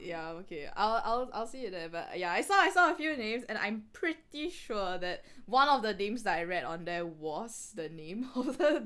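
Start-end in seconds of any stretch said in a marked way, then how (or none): none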